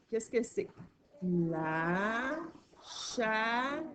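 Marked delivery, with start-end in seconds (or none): 1.2-3.9 slow syllables with pauses between; stretched-out sounding-out of the word; reading along together. Shown as a demonstration. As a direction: draw out each syllable so a child can follow. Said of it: "on va aller le lire ensemble".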